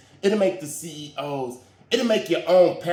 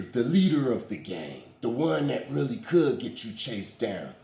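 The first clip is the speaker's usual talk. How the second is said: usual speaking voice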